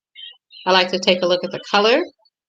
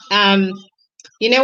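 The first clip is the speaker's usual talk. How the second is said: ordinary talk, nothing marked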